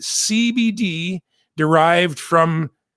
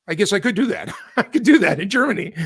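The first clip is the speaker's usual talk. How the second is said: laughing; 3 semitones higher, faster than usual. Real, not acted. Laughing at own slip.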